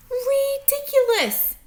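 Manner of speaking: high, loud gruff dog shout; dog jeering at the tortoise; barking dog scorn. Acted. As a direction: bark the word out like a dog.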